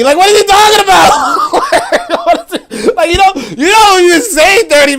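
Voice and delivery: high squealing laugh through the words. Real, not acted. Host cracking up at the quickie comment, squealing with laughter. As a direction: laugh so hard the voice goes up high.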